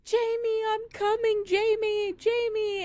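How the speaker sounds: put-on high, eager dog calling his boy; voicing Chance calling. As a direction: call out to Jamie as the happy dog. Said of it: "you hear".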